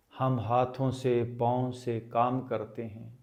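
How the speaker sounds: ordinary delivery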